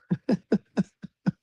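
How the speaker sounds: high chuckle at own joke; amused laugh. Real, not acted. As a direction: laugh in a quick high run.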